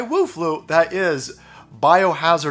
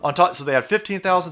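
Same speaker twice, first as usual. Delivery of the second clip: normal talking voice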